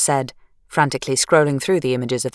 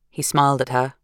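plain reading voice

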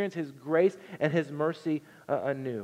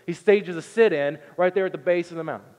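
ordinary delivery

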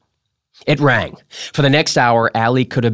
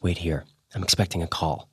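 much softer, hushed aside; whispered; 4 semitones lower, 10 dB quieter than usual. A narrator's reading. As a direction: keep it low so no one hears. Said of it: "I whispered".

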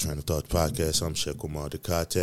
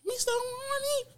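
falsetto answer; 6 dB quieter, slower than usual. Pretend. Answering the pretend phone.

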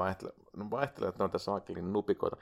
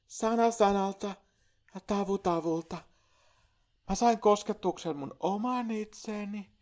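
dramatic spiritual testimonial; 8 semitones higher than usual. Acted.

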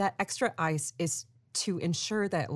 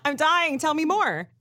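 mock excited; 9 semitones higher, 5 dB louder than usual. Real, not acted. Mock eagerness for trivia.

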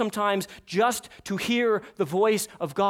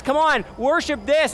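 a hint of a coaxing devil, louder; 7 semitones higher, 7 dB louder than usual. Devil luring believers, lightly voiced coaxing. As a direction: coax them toward sin. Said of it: "the devil trying to tell us".

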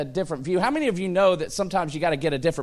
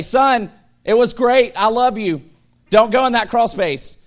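hammed-up dad pleading at his son; mock-dramatic plea; 9 semitones higher, 11 dB louder than usual. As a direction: beg the kid like it's goodbye forever.

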